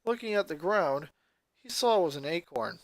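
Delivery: ordinary delivery, nothing marked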